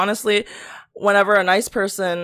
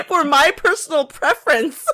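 louder, higher, laughing comeback; laughing retort; 8 semitones higher, 4 dB louder than usual. Retold or imitated. Retelling her retort to the coworker, loud and laughing. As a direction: snap back through laughter.

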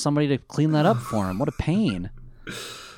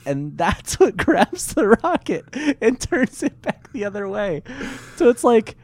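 laughing; 10 semitones higher, 4 dB louder than usual. Real, not acted.

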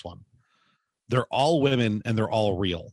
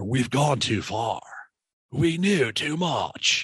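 slow, stretched-out bad movie acting; hammed-up action-film line, dragged out; Keanu Reeves character; 2 semitones higher, much slower than usual. Joking about going too far as a bad film character, slow and hammy. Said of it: "Be like a bad fucking Keanu Reeves character".

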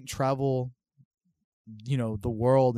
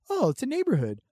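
put-on much higher, relieved little-kid voice; childlike surprise; 13 semitones higher, 5 dB louder than usual. A child arriving in Texas, surprised it is normal. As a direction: sound like a six-year-old pleasantly surprised. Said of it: "but I'm six".

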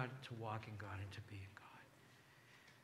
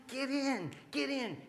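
high-pitched, pressing call; urgent beckoning; 13 semitones higher, 4 dB louder, slower than usual. Acted. Voicing God calling the hesitant in, high and urgent. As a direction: urge them inside, pitched way up.

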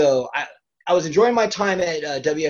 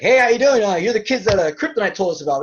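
friendly trainer greeting; 6 semitones higher, 5 dB louder, faster than usual. Retold or imitated. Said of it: "He's like".

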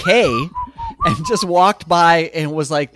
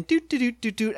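high little doot doot doots, tiptoeing; sneaky doot humming; 8 semitones higher, 4 dB quieter than usual. Retold or imitated. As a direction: hum doot doot while sneaking in. Sneaking again, doot sounds.

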